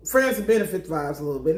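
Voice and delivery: usual speaking voice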